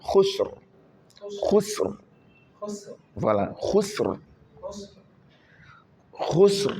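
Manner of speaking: Khusr with a clipped, heavy final r, pitched high; Khusr, tafkhim on the r. Shown as a demonstration. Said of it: "La prononciation de ra doit se faire avec tafkhim".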